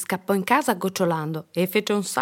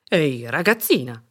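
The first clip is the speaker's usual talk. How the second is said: louder, firm man's voice; stern postman; 3 semitones lower, 5 dB louder than usual. A narrator's reading. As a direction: call a child to account. Postman scolds the girl.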